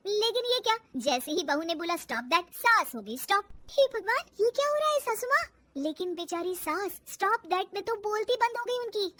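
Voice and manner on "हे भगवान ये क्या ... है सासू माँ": high, quick alarmed wonder; high-pitched bewilderment; astonished. Acted. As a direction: exclaim in shocked disbelief. Daughter-in-law astonished at frozen mother-in-law.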